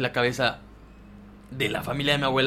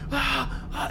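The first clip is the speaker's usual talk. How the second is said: high-pitched retold scream of a sleepwalking child; 13 semitones higher than usual. Boy screaming in his sleep at the beach house, high retold cries. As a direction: cry out high, imitating the night screams. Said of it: "empecé a gritar así".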